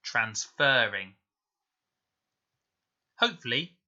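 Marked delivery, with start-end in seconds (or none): 0.0-1.1 transferring said slowly, the fer drawn out long; teacher slowly sounding out transferring, fer held long and stressed. Shown as a demonstration. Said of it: "it was making a long sound".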